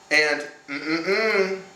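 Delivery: falling no hum, mm-mm-mm; denying mm-mm. Shown as a demonstration. Hum "mm-mm-mm" to a viewer as a no. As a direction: hum a firm no. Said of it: "why do they sound different".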